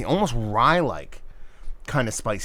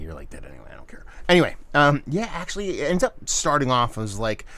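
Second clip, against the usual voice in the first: ordinary talk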